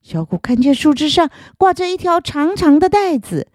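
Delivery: plain reading voice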